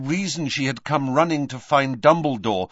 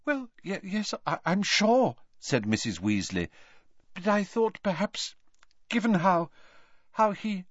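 flustered stammer; 9 semitones higher than usual. A narrator's reading. Mrs Weasley flustered, stammering.

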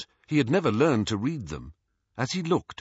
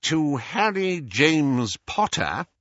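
slow, higher-pitched formal official; Scrimgeour reading a will; 6 semitones higher, slower than usual. Acted. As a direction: announce the heir's name, drawing it out. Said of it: "he read".

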